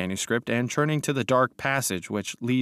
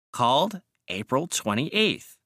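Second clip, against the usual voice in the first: slow, raised-pitch record reading; reading aloud; 4 semitones higher, slower than usual. A narrator's reading. Mister Wilde continues the ledger entry, slow reading aloud. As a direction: read out the entry with slow formality. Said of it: "continued Mister Wilde".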